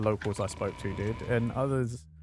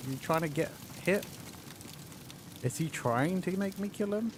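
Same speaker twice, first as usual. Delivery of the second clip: incredulous; 6 semitones higher, 7 dB quieter than usual. Real, not acted. Teammate burst around corner.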